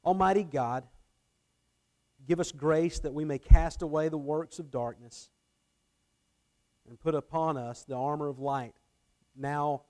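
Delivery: measured read prayer in short phrases, strong opening easing toward the Amen; formal prayer. Acted. Pastor leading the congregation in the Advent prayer, formal prayer. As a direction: read the collect solemnly, phrase by phrase.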